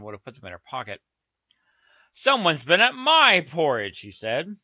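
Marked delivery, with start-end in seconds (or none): 2.1-4.0 slightly higher, louder, drawn-out bear complaint; slow, loud middle-sized bear; middle bear's middle voice. A narrator's reading. Middle bear finds his porridge touched, middle voice. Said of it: "in his middle voice".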